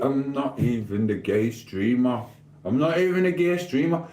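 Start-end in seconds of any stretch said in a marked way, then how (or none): 2.6-4.1 higher-pitched protest, rising at the end; emphatic denial. Real, not acted. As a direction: repeat the denial in a raised pitch. Repeats the denial more emphatically.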